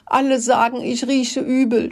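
high, tearful storybook voice; high-pitched whining sob; acted sobbing complaint. A narrator's reading. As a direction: wail it like a hurt little rat. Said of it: "schluchzte er".

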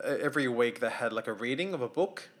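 usual speaking voice

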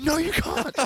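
loud, high, hammed-up no; mock yelled refusal. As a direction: shut the customer down at a yell. Acted staff refusing a customer.

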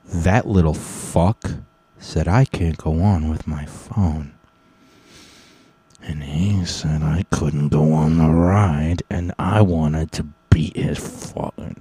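quiet, slow mock seething. Son complaining to his dad about the guard, slow hushed anger. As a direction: hold the rage down, low and drawn out.

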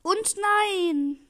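high, loud, drawn-out groan of frustration; anguished cry. Real, not acted. Gamer after almost scoring, loud anguished cry. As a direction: cry out at the near miss. Near miss on goal.

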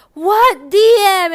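high, loud, wailed question; mock crying. Imitating Mister C hearing about the DMs, mock crying. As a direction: imitate a distraught man bawling in disbelief. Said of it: "crying out loud".

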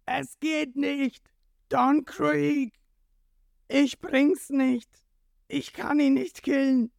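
high-pitched, slow, wailing yell; anguished bellow. A narrator's reading. Jin confessing to his captain, high desperate bellow. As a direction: cry it out desperately, drawing out each word. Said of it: "Doch dann brüllt er los".

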